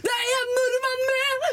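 high, thrilled cry that someone is in the lead; excited cry. Retold or imitated. Friend retelling the race, crying out in thrilled surprise. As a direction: shriek it out in disbelief and joy.

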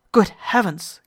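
sudden loud exclamation, the captain startled; cry of shock. A narrator's reading. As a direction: burst out in alarm. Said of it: "cried the captain".

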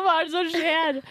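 baffled, what on earth is going on; bewildered. Retold or imitated. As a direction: woken by banging, confused. Confused at sudden banging.

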